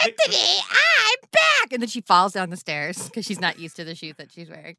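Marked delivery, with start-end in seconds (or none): 0.0-1.7 high put-on voice, announcing a return; hammed-up high impostor voice; Janice. Disguised Chalice greets Anthony, high fake Janice voice. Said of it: "what Janice's voice sounded like".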